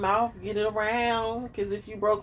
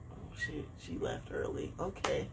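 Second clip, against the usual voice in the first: hushed whisper, no voice; 14 dB quieter than usual. Retold or imitated. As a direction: whisper it like a secret aside. Therapist voiced in retold story.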